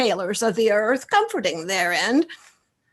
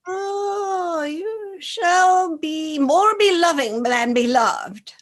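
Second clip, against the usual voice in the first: slow breathy whisper of a seer; mystic soothsayer; 6 semitones higher, slower than usual. Acted. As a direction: whisper the prophecy slowly, like an oracle. Soothsayer delivers prophecy.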